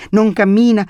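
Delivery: put-on high-pitched smug little boy; know-it-all child. A narrator's reading. Boy continuing about grandmother to visitor, high know-it-all tone. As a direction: carry on as a small know-it-all. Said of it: "proseguì Camillo in tono saccente".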